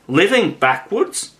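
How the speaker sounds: astonished, rising question. A narrator's reading. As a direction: echo it in disbelief. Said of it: "in great astonishment".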